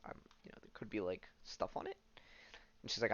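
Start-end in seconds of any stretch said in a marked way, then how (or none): 0.1-2.0 whispered aside. Real, not acted. Aside after being afraid to look.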